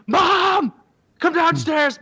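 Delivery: high, loud call up the stairs; yelling for mom. Retold or imitated. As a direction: call her down in a panic. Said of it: "I yelled up".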